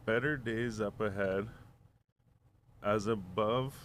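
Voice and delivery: slow, softly spoken pronouncement with long pauses; slow recitation. Retold or imitated. Retelling a stranger's saying to friends, slow recitation. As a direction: recite it slowly, line by line.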